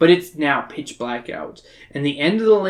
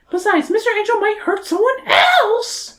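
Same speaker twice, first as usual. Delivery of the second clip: put-on very high girlish Mina voice. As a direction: squeak it out like a young girl.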